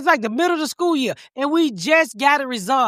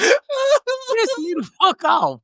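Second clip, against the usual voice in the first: laugh-crying; 10 semitones higher, much slower than usual. Real, not acted. Laughing-crying over the cat hair.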